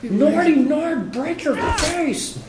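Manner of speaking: very high, slow warrior taunt; mock Nord voice. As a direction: ham up a brutish Nord. Mock Nord battle cry.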